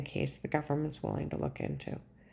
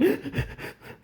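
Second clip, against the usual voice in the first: blubbering gasps; 13 semitones higher than usual. Retold or imitated. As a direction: gulp air as if blubbering. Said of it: "And I, I'm blubbering".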